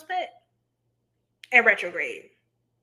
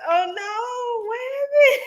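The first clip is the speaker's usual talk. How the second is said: high, rising gasp of concern; worried concern; 11 semitones higher, slower than usual. Real, not acted. Reacting to a viewer's rough day, high worried gasp. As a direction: sound alarmed for a viewer. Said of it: "I'm still traumatized".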